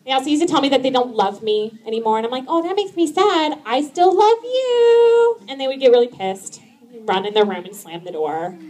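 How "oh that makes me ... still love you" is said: high, slow, syrupy sarcasm; sarcastic sing-song reply to kids; high slow mock-sweet voice to angry kids; sarcastic sweetness.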